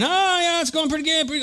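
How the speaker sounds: mock delight. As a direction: overconfident boater on a good day. Boat going well before dying.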